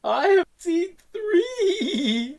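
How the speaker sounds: high, slow, stretched villain cry; hammy villain shout. Retold or imitated. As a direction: ham it up like a mad villain. Quoting villain's hammy line.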